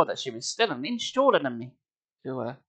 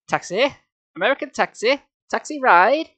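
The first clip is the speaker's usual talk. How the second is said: higher, louder, pushy taxi call; insisting; 7 semitones higher, 4 dB louder than usual. Acted. Robed man insisting to the boy, higher pushy call. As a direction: keep pressing the offer of a ride. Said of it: "he insisted".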